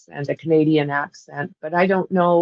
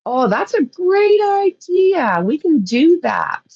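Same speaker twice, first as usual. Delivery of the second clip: excited; 9 semitones higher, 4 dB louder, slower than usual. Real, not acted. Delighted by a chat idea.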